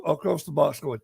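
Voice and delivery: plain reading voice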